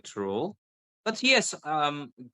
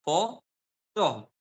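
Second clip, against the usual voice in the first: "Photo" said slowly in two separate beats; stress demo; 3 semitones higher than usual. Shown as a demonstration. Teacher showing the stress point, emphatic "Photo". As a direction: break "Photo" apart with emphasis. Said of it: "is the stress point".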